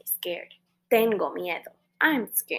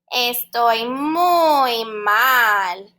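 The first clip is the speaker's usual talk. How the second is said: slow, drawn-out moaning unicorn voice; a slow mopey creature complaint; sad unicorn puppet; 5 dB louder, much slower than usual. Acted. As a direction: whine it slowly like a glum toy unicorn. Unicorn says he feels bad.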